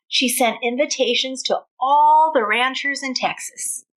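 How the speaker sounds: lively read-aloud with a country twang; storybook reading. A narrator's reading. Teacher reading a cowboy tale to her class, lively twang.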